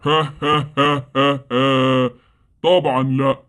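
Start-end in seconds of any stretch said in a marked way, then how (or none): 0.0-2.1 spaced-out villain's ha ha ha; mocking monster laugh. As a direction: laugh at her like a gloating monster. (ضحكة وحش ساخرة)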